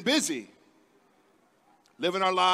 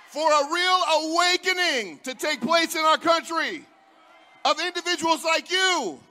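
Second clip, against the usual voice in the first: roaring rallying speech; 5 semitones higher than usual. Real, not acted. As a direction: build it up for the crowd. Rally speech building to a climax.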